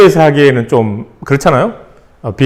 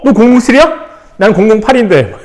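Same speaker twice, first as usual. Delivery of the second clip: put-on higher, playful boy bragging back; mock surprised kid; 7 semitones higher, faster than usual. Kid answering the 007 boy with mock surprise. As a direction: tease like a kid topping his friend. Kids playing spy, mock reply.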